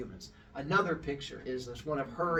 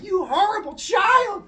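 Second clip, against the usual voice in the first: high, loud, slow acted scream; enraged screaming; 11 dB louder than usual. Storyteller as goddess attacking the girl, enraged mock screaming. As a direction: shriek a furious scolding, stretching the words. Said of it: "starts screaming and going over to Arachne".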